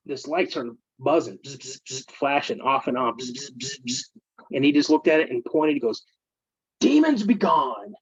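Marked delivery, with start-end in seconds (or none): 1.4-2.1 imitated buzzing. Imitating buzzing beer light. 3.2-4.0 imitated buzzing. Imitating buzzing beer light. 6.8-7.9 mock exorcist command. Friend commands light to stop.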